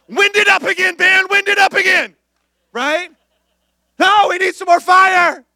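high, loud, fired-up cheering; hyped shouting. Pretend. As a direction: crank the room up like a preacher.

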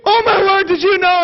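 high, loud gossipy squeal; mock freaking out. Lady to her friend over a magazine, mock freak-out. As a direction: gush it shrieking, hammed-up. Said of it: "she's just freaking out".

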